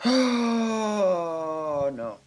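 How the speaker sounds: long, high, loud groaned "oh"; dismayed groan. Real, not acted. Player groans at a build getting messy, dismayed. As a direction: draw out a high, sinking "oh no".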